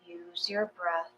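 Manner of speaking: ordinary talk, nothing marked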